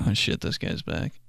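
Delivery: whisper. Retold or imitated. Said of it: "they're like".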